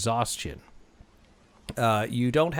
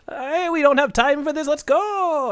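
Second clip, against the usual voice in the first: high, loud, impatient voice; squeaky leprechaun urging haste; 13 semitones higher, 6 dB louder than usual. Acted. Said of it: "the leprechaun says".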